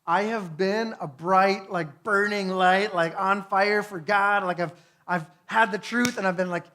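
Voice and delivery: impassioned prayer, retold; crying out. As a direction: pour out the frustration to God. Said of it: "crying out to God".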